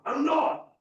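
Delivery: high loud defensive snap; high huffy denial; mock denial. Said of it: "you're like".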